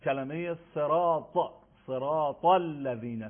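slow recitation of صراط; emphatic ta in صراط. Shown as a demonstration. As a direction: stretch صراط and weigh down its ط. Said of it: "در نماز هم".

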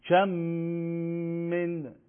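«کم من» joined on one long hummed m; merged doubled mim. Shown as a demonstration. Teacher demonstrating idgham to students, doubled mim held long. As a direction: hold the m of «کم» into «من».

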